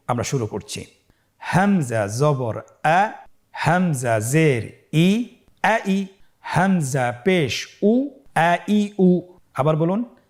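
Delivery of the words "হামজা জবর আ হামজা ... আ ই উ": drawn-out syllables in an even chant; stretched, measured letter-by-letter recitation; slow spelling drill. Shown as a demonstration. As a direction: lead learners through each sound, very slowly.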